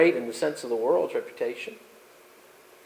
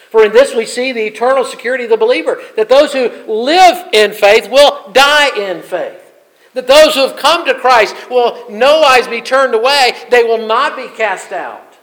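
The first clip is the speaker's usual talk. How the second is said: loud, higher, driving sermon delivery; full-force preaching; 6 semitones higher, 8 dB louder than usual. Acted. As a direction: preach it out to the whole church.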